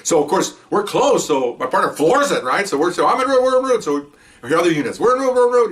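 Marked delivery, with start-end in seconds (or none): 3.1-3.8 high, hurried call-in; excited officer responding on the radio; urgent radio response. Retold or imitated. 5.0-5.7 high, excited call-in; re-enacted officers piping up on the radio; urgent radio response. Acting other units' radio responses.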